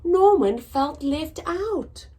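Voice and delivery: lively storybook reading, big swoops in pitch; reading to children. A narrator's reading. Teacher reads to children, bright storybook voice. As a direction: read it out to little listeners. Reads picture book aloud.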